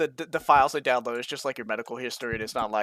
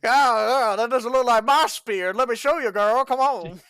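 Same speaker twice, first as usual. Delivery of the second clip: pitched-up goofy character voice, a bit louder; high put-on voice; 10 semitones higher, 3 dB louder than usual. A goofy caveman boasts to a girl, high put-on voice. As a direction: play a cocky caveman showing off his spear.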